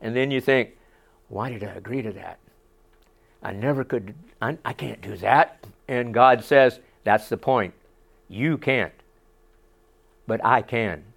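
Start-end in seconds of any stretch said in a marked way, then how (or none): 1.3-5.7 low, hushed, under the voice; regretful inner thought. Acted. Believer privately regretting a yes to God, low hushed aside. 7.0-11.0 low, soft reply; God's quiet answer. Acted. God answering the doubting believer, low and quiet.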